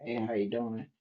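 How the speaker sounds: low, soft casual hello; low quiet greeting. Shown as a demonstration. Host demos her low-voice day to listeners, low and soft. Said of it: "Some days I wanna sound like".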